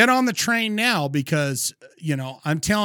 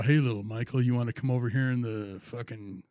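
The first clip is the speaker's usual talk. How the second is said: creepy big guy; 4 semitones lower, 4 dB louder, faster than usual. Retold or imitated. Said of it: "he looks like a guy that'll stand over you and go".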